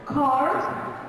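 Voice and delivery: American "car" with a sounded R; American accent. Shown as a demonstration. Teacher contrasts American with British car, American accent. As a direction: curl the final R in "car", American style. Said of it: "the British is gonna say car".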